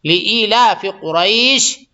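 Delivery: لی ایلاف قریش drawn out very slowly; teacher's slow demonstration; slow model reading. Shown as a demonstration. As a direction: recite it slowly for students to copy. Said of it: "تو لے ایلاف نہیں ہے".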